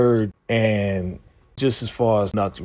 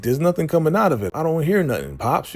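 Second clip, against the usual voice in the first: higher, fast whiny mock complaint; 8 semitones higher, faster than usual. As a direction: pitch it up and rush the protest. Emmett retold complaining, pitched up.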